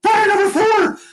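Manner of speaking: very high, strained mock shout; retold alarm call. Plane captains call out an engine fire, retold alarm. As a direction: imitate an emergency cry.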